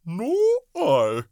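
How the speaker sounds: very high little ladybug. A narrator's reading. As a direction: squeak it like a tiny bug. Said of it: "the ladybug said".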